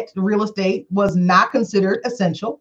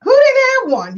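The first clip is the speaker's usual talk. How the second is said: high-pitched, louder-ish rhetorical cry; mock disbelief; 14 semitones higher, 4 dB louder, faster than usual. Real, not acted. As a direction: throw it out as an incredulous question. Rhetorical question about everyone's old account.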